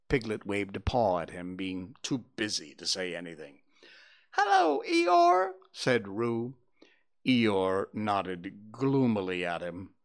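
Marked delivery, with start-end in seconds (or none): 4.3-5.5 put-on very high squeaky baby kangaroo; squeaky little Roo. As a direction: squeak it out like an excited little joey. Roo's voice.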